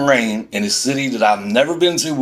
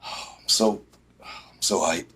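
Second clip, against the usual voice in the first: breathy, much softer, deflated sarcasm; sarcastic hush; 6 semitones lower, 10 dB quieter, slower than usual. Real, not acted. Venting about the scam host, then drained sarcastic hush.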